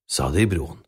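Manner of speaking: ordinary delivery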